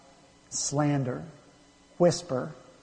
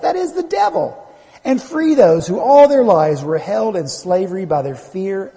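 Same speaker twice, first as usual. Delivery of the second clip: emphatic, higher-pitched pulpit delivery; full-force preaching; 7 semitones higher, 6 dB louder than usual. Real, not acted. Preacher telling the congregation of Satan's defeat, full-force preaching. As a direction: preach it with conviction, driving key phrases home.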